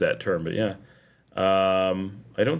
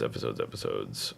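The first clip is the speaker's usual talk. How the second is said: much lower and much softer, dropping to a deep rumble; 11 dB quieter than usual. Acted. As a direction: growl "episodes" way down low and quiet. Repeats word in silly voices.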